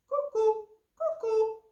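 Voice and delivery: high, loud two-note bird call; cuckoo. Acted. Cuckoo spots the fleeing fish, cuckoo call. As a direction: hoot it out, falling on the second note.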